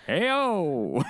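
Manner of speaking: high, showy impressionist's greeting; mock Rich Little. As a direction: call it out like a hammy entertainer. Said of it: "Rich Little just being like".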